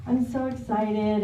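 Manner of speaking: ordinary delivery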